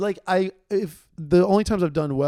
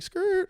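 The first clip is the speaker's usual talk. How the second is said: high-pitched "skrrt" ad-lib; playful high car-screech noise; mimicked tire screech. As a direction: squeal it like tires pulling up. Imitating tires when pulling up.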